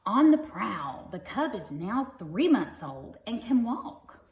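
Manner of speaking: lively, bright storybook reading; reading to children. Acted. Parent reading a tiger book to kids, lively storybook voice. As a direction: read it like a bedtime picture book.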